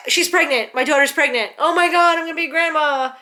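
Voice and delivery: high, loud burst of joy; imitating an overjoyed mom's outburst; retold excited screaming. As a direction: exclaim as it all hits at once. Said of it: "She was screaming".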